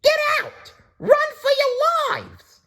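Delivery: very high screeching alarm; screaming bird. A narrator's reading. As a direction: screech a panicked warning.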